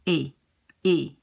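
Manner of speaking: "E" as the vowel in say; closed ay sound on each "E"; closed French é. Shown as a demonstration. Teacher repeats the accented vowel, closed é. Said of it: "in the word say".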